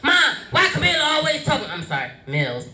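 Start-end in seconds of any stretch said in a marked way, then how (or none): 0.0-1.7 put-on high, fast little-kid whine; brother's complaint. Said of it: "I upset my brother".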